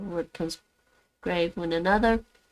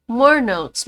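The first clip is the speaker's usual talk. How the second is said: excited; 4 semitones higher, 10 dB louder than usual. Real, not acted. Excited about more notes.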